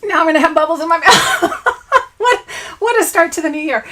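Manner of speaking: fast, laughing through the words; giggling apology. Real, not acted. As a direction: laugh at your own mess. Laughing at own mishap.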